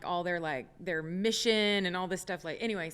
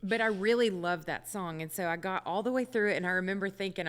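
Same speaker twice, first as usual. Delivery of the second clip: normal talking voice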